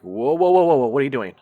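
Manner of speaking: loud, high startled protest; startled whoa. Retold or imitated. Narrator reliving being grabbed, startled and loud. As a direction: shout it out in shock. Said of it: "and I'm like".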